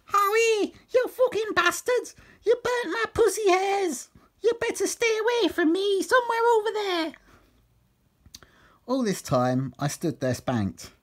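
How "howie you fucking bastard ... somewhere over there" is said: shrieky falsetto tirade; angry woman character. Acted. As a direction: scold furiously in a very high put-on voice. Burnt woman rages at the men.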